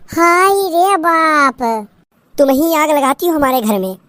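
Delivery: slow, drawn-out village lament; wailing mother-in-law. Acted. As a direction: cry out to the heavens in shame.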